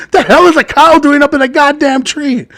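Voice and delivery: high-pitched incredulous outburst; baffled outburst. Acted. Police officer hearing a cow in a tree, incredulous. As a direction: blurt it out in total disbelief.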